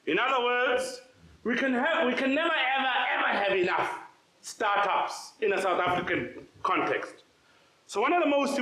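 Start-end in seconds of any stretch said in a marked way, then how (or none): none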